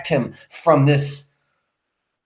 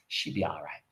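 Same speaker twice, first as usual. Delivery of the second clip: soft, low soothing voice; speaking softly; 6 semitones lower, 10 dB quieter than usual. Acted. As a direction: soothe her gently and low. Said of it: "speaking softly".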